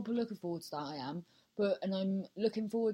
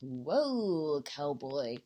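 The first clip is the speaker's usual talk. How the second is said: long drawn-out, lower whoa; mock examiner's whoa; 4 semitones lower, much slower than usual. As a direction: imitate an examiner reining in a driver. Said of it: "when your examinator says that".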